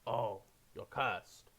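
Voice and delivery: slow, drawn-out realization; a shopkeeper finally seeing the curse, slow and grave. Acted. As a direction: let it dawn slowly, each word stretched. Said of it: "and he goes".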